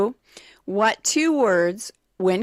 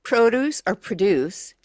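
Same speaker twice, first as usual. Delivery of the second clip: PROduce or proDUCE; noun stress, then verb stress. Shown as a demonstration. Quiz host listing noun and verb pairs, shifting stress on "produce". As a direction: stress "produce" on the first syllable, then on the second. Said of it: "accent on the second syllable".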